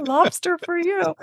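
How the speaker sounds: high, slow, laughing flourish; mock generous cheer. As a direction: announce it grandly in a high put-on voice. Joking pretend largesse while broke.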